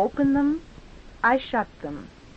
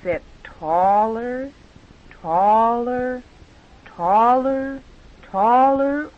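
building taller; 3 semitones higher, slower than usual. Shown as a demonstration. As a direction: climb step by step in pitch and volume. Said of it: "sit still taller by repeating this".

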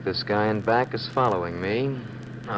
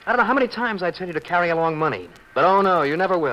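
raised, higher husband's nagging; exasperated scolding; 6 semitones higher, 4 dB louder, faster than usual. Acted. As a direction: scold her, voice rising. Husband scolds wife over money.

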